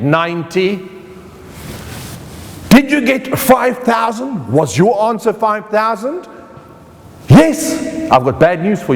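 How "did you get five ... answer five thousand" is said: usual speaking voice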